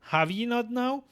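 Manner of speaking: put-on crone in an old-fashioned dialect; sly old midwife. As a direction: tease them slyly as an old crone. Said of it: "With a sly old cackle".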